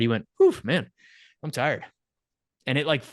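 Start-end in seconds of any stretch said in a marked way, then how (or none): 0.4-1.8 higher, punchy "whew", then a pointed pause; mock tired. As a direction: imitate someone pointedly pretending to be worn out. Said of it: "not like he was actually tired".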